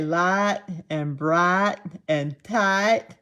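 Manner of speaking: slow hillbilly vowels in "light and bright and tight and height"; old Southern twang. Shown as a demonstration. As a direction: demonstrate your childhood Southern twang, stretching each vowel. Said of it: "I used to say".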